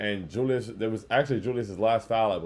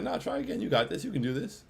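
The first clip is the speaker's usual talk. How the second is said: put-on coaxing a little kid; 8 semitones higher than usual. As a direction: cheer on a small kid who keeps missing, in mock pity. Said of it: "It's like when you playing with a little kid".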